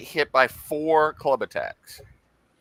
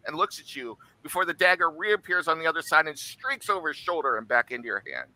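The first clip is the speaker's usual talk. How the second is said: dramatic combat narration; game master narration; 4 semitones higher than usual. A narrator's reading. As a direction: paint the strike for the table. DM narrates attack.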